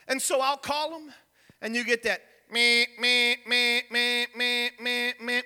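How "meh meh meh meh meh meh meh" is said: flat repeated meh of a busy tone; imitated busy signal; busy signal imitation. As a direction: mimic the phone's busy beeps. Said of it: "You know what it means? Busy".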